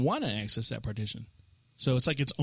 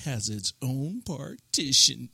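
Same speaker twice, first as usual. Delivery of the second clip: slow, drawn-out boast; proud announcement; slower than usual. Real, not acted. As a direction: stretch out each word for effect. Showing off the computer's special feature.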